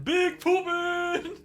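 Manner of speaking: high, slow, drawn-out silly yell; catchphrase yell. Pretend. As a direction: stretch it out high like a goofy battle cry. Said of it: "That's what I say here in the lounge".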